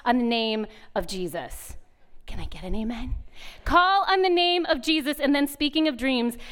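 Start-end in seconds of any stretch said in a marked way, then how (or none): 2.2-3.2 hushed, softer plea for an amen; quick confidential whisper; whispered aside. Real, not acted. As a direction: whisper it to the audience.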